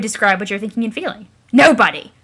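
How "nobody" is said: loud defiant bark; angry shout. Acted. Troll snapping at the narrator voice, loud and defiant. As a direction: slam the word down.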